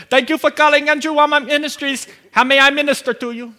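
high, loud imitation of an Indian phone minister; eager Indian-accented call greeting, put on; Jerry, Indian accent. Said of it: "precious little Indian guy named Jerry".

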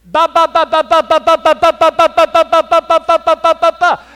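loud, high mock chant of "ba, ba, ba"; loud repeated ba syllables. Pastor mocking a one-syllable prayer language with loud chanted ba. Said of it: "it's just like one syllable".

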